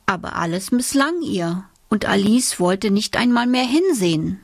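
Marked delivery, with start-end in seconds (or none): none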